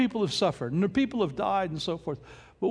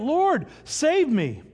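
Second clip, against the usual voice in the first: mild cry for help; 7 semitones higher, 3 dB louder, much slower than usual. A narrator's reading. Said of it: "he cried out".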